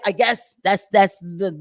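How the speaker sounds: ordinary talk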